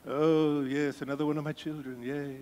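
hammed-up British old man as God; mock British accent for an old-man God; horrible British accent. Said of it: "that's a horrible British accent".

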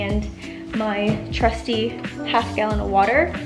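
normal talking voice

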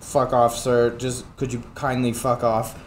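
a courteous voice saying rude words; mock polite brush-off. Streamer voicing the sales guy's polite wish to be rid of him.

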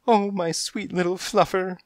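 high, tender coo; high motherly coo to a kitten. Acted. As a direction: coo over the baby sweetly. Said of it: "she cooed".

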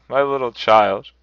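grieving father crying out aloud. Acted. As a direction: let the father's grief break loose. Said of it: "cried Bob".

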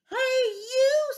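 calling out. Acted. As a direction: call out for a fight. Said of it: "said the grouchy ladybug".

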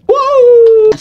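high, loud astonished wow; a sudden loud shout of wonder; surprised shout. Real, not acted. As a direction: yell it out in amazement. Big reaction in game.

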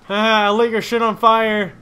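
loud, high victory jeers; gloating taunts. Real, not acted. Gamer who set an enemy's ride on fire, gloating shouts. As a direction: yell gloating taunts at a foe.

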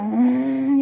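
imitated animal growl. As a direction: mimic a funny cross pet noise. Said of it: "Çok komik bir ses çıkarıyor". (köpek gibi kızgın ses taklidi)

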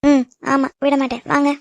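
ordinary talk, nothing marked

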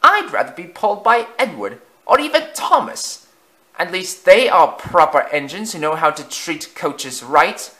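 a fussy carriage scolding; prim brake coach. Acted. Brake coach compares Afton to Edward, high and prim. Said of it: "the brake coach added".

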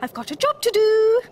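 high, loud call in passing; urgent cry. A narrator's reading. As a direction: cry out as a busy little Mole. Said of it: "cried Mole".

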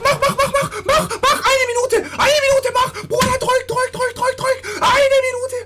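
high, loud, desperate begging at the screen; frantic pleading. Real, not acted. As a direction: beg the game to let you score, half laughing. Frantic pleading at game clock.

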